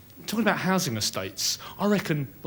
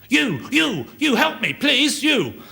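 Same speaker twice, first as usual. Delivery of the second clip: higher, pleading cry for help; a collapsed jogger calling to a passer-by; 12 semitones higher, 4 dB louder, slower than usual. Retold or imitated. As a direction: call out weakly for help from the dark.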